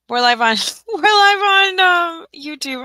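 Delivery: high, loud, breaking into a laugh; exasperated laughing announcement. Real, not acted. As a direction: announce it through helpless laughter. Awkward announcement after failed Facebook stream.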